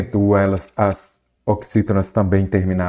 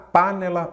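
PANELA said as PÁ-ne-la, higher on PA; stress on first syllable; 7 semitones higher than usual. Shown as a demonstration. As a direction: wrongly stress the opening PA of panela. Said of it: "Qual que é a sílaba tônica?". (panela com a tônica no PA inicial)

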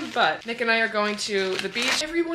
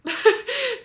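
high burst of laughter; sudden loud, high-pitched laugh; 7 dB louder than usual. Real, not acted. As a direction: let out a delighted high laugh. Laughs at her own reaction.

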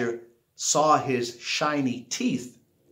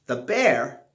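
plain reading voice

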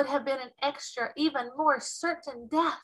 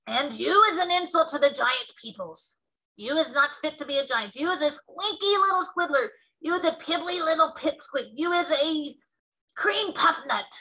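indignant tirade; 5 semitones higher, 5 dB louder than usual. Acted. BFG berating the giant.

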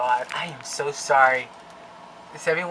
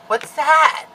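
alarmed; 10 semitones higher, 10 dB louder than usual. Real, not acted. Alarmed at camera glitch.

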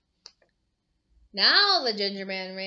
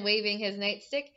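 ordinary delivery, nothing marked

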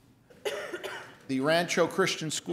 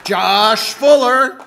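loud, slow, drawn-out name call; radio announcer voice; 5 semitones higher, 8 dB louder, much slower than usual. Pretend. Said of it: "sound like my best radio announcer's voice".

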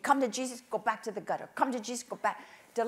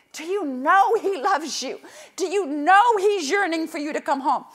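passionate plea; 6 semitones higher, 11 dB louder than usual. Retold or imitated. Fatherly plea to drunk man.

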